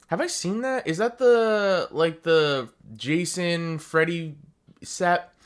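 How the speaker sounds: excited. Real, not acted. Recognizing a keycap set.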